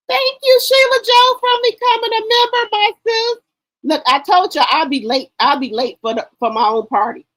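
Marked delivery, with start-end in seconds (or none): 0.1-3.4 high, loud thank-you, much higher pitched; hype shout-out. Real, not acted. Host thanking a new member, excited high shout-out.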